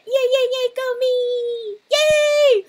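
high, loud victory shrieks; excited screaming. Real, not acted. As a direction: scream with joy at winning. Celebrating win.

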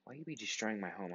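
ordinary talk

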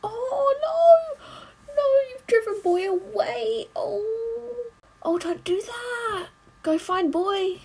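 high-pitched wail of dismay; dismayed outcry. Real, not acted. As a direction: cry out in high, drawn-out distress. Reacting in dismay to a film.

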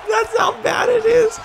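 high, slow words through laughter; helpless laughing. Real, not acted. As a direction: wheeze out the line while cracking up. Laughing at how hard he was hit.

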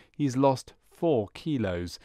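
usual speaking voice

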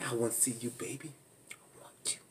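soft breathy whisper; mock seductive whisper. Vlogger mocking Michael seducing Megan, whispered. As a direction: breathe it low and intimate. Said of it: "talking about".

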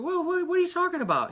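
high, fast, bewildered question; retelling his own startled reply in a dream; baffled. Retold or imitated. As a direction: act totally lost and confused. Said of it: "And I said".

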